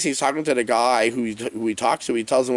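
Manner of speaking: usual speaking voice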